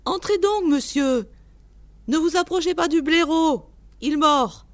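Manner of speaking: high, loud welcome turning to warning; eager old host. A narrator's reading. Old keeper warns visitor about the badger, high and loud. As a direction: call the guest in, then warn him.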